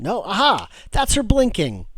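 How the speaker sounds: high, loud sudden delight; excited discovery. Real, not acted. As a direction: burst out as you spot it. Discovers the blink animation works.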